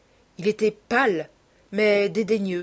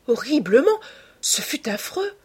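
appalled exclamation; 8 semitones higher than usual. A narrator's reading. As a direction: leap up high in horrified reproach. Appalled youth repeats his verdict. (exclamation horrifiée, aiguë)

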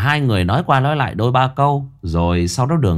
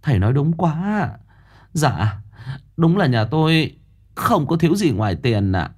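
higher, eager voice of the village mother; pleading with the fortune teller, higher; mother of Tân; 6 semitones higher than usual. A narrator's reading. Mother begging the master, high and eager. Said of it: "Mẹ thằng Tân lại lên tiếng".

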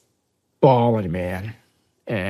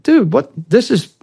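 retold disbelief; 12 semitones higher, 8 dB louder than usual. Marine balks at the hundred-dollar demand, acted incredulous protest. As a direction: voice leaps very high and loud.

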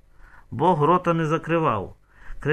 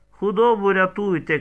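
higher-pitched urgent cry; moderately raised cry; narrated shout; 6 semitones higher, 4 dB louder than usual. A narrator's reading. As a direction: shout for help, storyteller style. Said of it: "крикнула Тамарка".

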